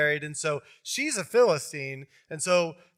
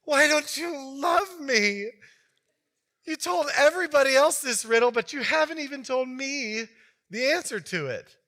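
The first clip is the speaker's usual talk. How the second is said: guilt him like a sulking fiancee; whiny fiance; 9 semitones higher, 5 dB louder than usual. Retold or imitated. Karen manipulating Samson for the answer, high whiny pleading. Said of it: "plays the oldest card".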